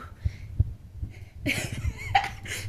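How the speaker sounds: long high-pitched laugh; high laughter. Acted. Medium channelling a returning spirit, high laughing. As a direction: burst into high, breathy laughter.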